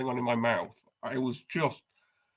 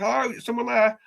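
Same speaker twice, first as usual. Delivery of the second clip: high, louder polite call through the door; mock caller outside; 10 semitones higher, 5 dB louder than usual. Stranger knocking, calling to whoever's inside, high and polite.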